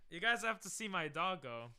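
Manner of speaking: usual speaking voice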